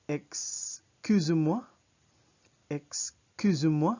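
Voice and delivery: loud, slow "Excusez-moi", clear Z and rising end; French pronunciation. Shown as a demonstration. Teacher models "Excusez-moi" for repeating, slow and loud. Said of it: "Notice that it's z".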